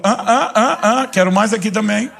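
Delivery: put-on playful childlike clamoring for a share; mock greedy pleading. Begging God to fill his cup.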